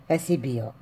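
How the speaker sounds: normal talking voice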